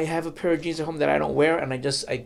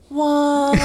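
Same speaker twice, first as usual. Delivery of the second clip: mock surprise; 9 semitones higher, 6 dB louder than usual. Voicing the fans' shocked reaction.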